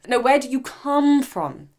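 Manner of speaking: the lady insisting. Retold or imitated. Host voicing the lady pressing the visitor, slow and insistent. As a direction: press the question slowly, a mock persistent lady.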